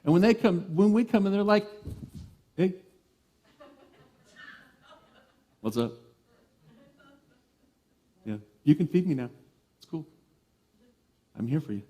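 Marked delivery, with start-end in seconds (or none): 2.6-11.8 laid-back, unbothered cat voice, a bit soft; mellow cat. Retold or imitated. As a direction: shrug it off like a cat who barely looks up.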